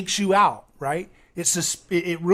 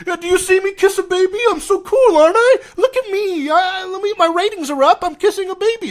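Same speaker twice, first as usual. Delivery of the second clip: much higher, louder boastful mock voice; mocking Trump; 14 semitones higher, 8 dB louder than usual. Mocking Trump bragging about kissing a baby. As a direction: show off like a vain leader kissing babies. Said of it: "He's like".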